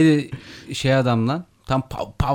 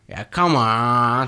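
mock come on; 7 semitones lower, much slower than usual. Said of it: "böyle bir şey yapıyor".

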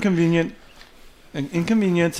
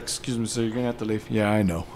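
quiet usher; 7 semitones lower, 10 dB quieter than usual. Pretend. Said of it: "It's like".

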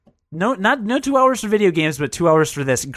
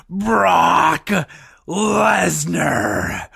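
booming ring announcer call; announcer shout; much slower than usual. Pretend. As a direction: belt the name out like a ring announcer. Wrestling announcer callout.

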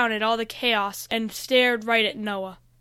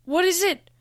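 high-pitched, eager question with a touch of fear; eager but scared; 7 semitones higher, 3 dB louder than usual. A narrator's reading. Noah asking about the dark power, eager but scared. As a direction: ask eagerly, a little scared. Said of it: "eager but scared at the same time".

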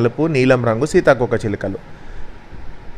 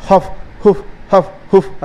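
forceful wolf blowing in separate puffs; acted gusts of breath; huffing and puffing; 7 semitones higher than usual. A narrator's reading. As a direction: huff and puff at the house. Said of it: "అని ఊదింది".

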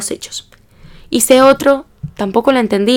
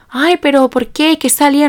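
imitating concerned taxi driver; 6 semitones higher than usual. Taxi driver asking the crying student about the exam, high-pitched concern. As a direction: coo the questions in a raised voice.

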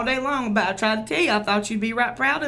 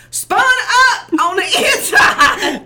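laughing; 10 semitones higher, 8 dB louder, much slower than usual. Real, not acted. Laughs while explaining.

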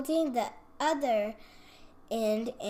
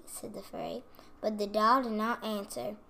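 plain reading voice

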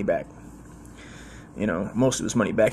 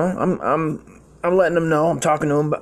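ordinary talk, nothing marked

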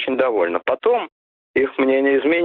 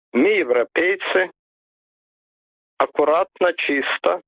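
put-on Baltic accent; 4 semitones higher, slower than usual. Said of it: "прибалтийские депутаты".

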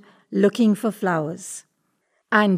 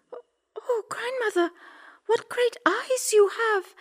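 put-on very high, astonished girl voice; gasp-like high girlish wonder; wondering little girl; 13 semitones higher than usual. A narrator's reading. Girl marvels at disguised wolf.

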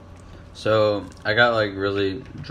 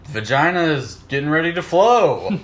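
commercial voice; 6 semitones higher, 5 dB louder than usual. Pretend. Repeats the pad ad.

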